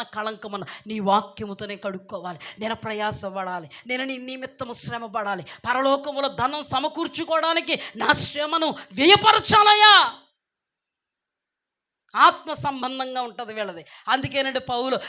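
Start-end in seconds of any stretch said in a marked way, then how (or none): 5.4-10.2 slow, loud preaching climax; fervent loud sermon peak; preaching shout. Real, not acted. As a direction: drive the last line home with a shout. Preacher climaxes prayer point.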